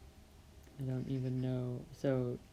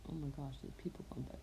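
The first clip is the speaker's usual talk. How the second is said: hushed; 9 dB quieter, faster than usual. Real, not acted. Dogs may bark at passersby.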